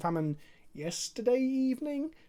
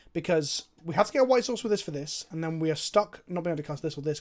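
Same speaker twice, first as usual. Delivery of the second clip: ordinary talk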